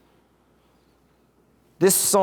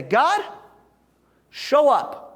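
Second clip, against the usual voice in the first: demanding prayer; 7 semitones higher, slower than usual. Retold or imitated. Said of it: "biblical permission to say".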